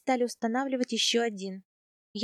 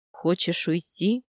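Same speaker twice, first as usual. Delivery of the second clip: a hint of a lower, slow khan voice asking; lightly voiced khan; 5 semitones lower, slower than usual. A narrator's reading. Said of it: "пристально глянул на меня хан". (слегка пониженный голос хана, медленно)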